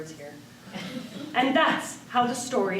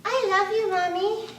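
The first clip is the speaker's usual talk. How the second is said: talking doll; 6 semitones higher than usual. Acted. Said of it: "She says".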